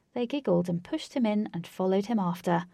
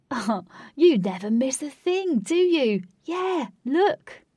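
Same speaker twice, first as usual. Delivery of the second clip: higher, louder, playful teasing; a higher, louder voice teasing a nosy friend; teasing Lynx voice; 7 semitones higher, 6 dB louder, slower than usual. A narrator's reading. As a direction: tease her for never missing a thing.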